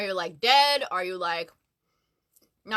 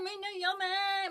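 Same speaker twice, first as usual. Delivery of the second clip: slow, higher attempt at an Australian accent; playful, half-English Australian accent; 8 semitones higher, 7 dB quieter, slower than usual. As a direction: try to sound Australian for an Australian friend. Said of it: "that was kinda English".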